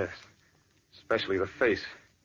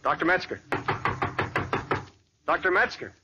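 higher, calling into the room; 5 semitones higher than usual. Acted. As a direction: call his name toward the door. Calling through the lab door.

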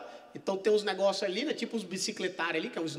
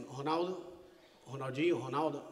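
low, soft confused mumble; nervous mumble; 6 semitones lower, 8 dB quieter than usual. Retold or imitated. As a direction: fumble his own name nervously. Nervous Ronaldinho confused at hearing.

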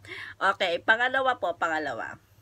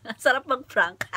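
usual speaking voice

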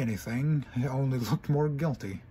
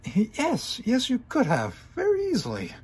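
high, pointed pony voice; high sarcastic mare; indignant retort; 11 semitones higher, 4 dB louder than usual. Acted. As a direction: snap back indignantly.

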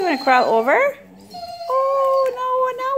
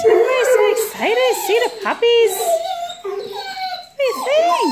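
laughing through tears; 6 semitones higher, 3 dB louder than usual. Real, not acted. Emotional over puppies.

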